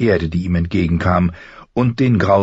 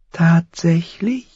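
high, slow whispery crow; high, thin voice; 10 semitones higher, slower than usual. A narrator's reading. Crow doubts the boy's dream, high and thin. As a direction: draw the question out thinly. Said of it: "Die Stimme klang hoch und dünn".

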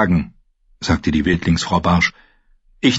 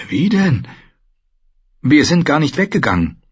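put-on a touch higher, eager boy; young prince explaining, lightly voiced; 7 semitones higher than usual. Bran explains to the maester, lightly voiced boy.

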